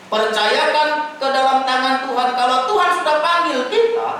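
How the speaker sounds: high, loud, impassioned sermon voice; preaching at full force. Acted. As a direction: preach it out loud, rising with fervour. (khotbah berapi-api dengan suara keras dan tinggi)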